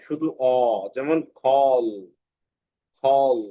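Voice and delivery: খল with a long rounded অ, slow; drawn-out o sound. Shown as a demonstration. Teacher modelling for students, slow rounded vowel. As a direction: stretch the vowel of খল into a full অ. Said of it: "অ এর মতো হয়".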